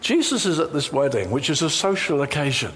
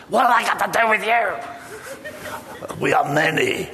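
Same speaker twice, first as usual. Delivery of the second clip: demon voice; 3 semitones higher, slower than usual. Acted. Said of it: "He says".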